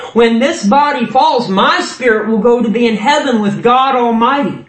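high, ringing declaration of faith; impassioned sermon climax; fervent preaching. Real, not acted. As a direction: proclaim it to the congregation with conviction. Preaches with rising fervour.

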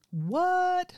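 high, rising incredulous yelp. Retold or imitated. Reacting to a stranger's meditation claim, incredulous. Acting her baffled reaction to caller.

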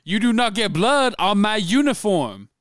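high, loud drill-style coach bellow; hammed-up Titans coach shouting orders; movie coach impression. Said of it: "now we're coaching Remember the Titans".

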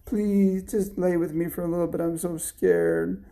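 put-on high, pleading child voice; scared little girl. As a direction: plead like a frightened small daughter. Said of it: "she was having a nightmare".

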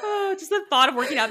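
breathless laughing restart. Real, not acted. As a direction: laugh your way back into the sentence. Restarts laughing after choking.

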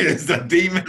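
laughs through the words, loud; cracking up while repeating it; laughing. Real, not acted.

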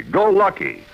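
ordinary talk, nothing marked

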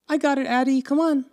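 lightly voiced kid, pitched high; imitated big sister. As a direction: call a little brother along in a high voice. Voicing sister calling little brother.

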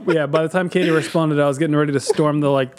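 normal talking voice

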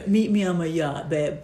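lightly voiced smug rich guy. Same quote repeated.

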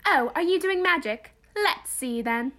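Hermione quote. Retold or imitated. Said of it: "I'm Hermione Granger".

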